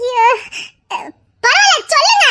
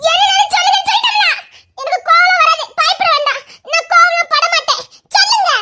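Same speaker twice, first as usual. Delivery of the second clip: higher, fast giggling plea; giggly pleading; 5 semitones higher than usual. Real, not acted. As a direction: coax him to tell, laughing. Wife pleads, mock reassuring husband. (சிரித்தபடி உயர்ந்த குரலில் கெஞ்சல்)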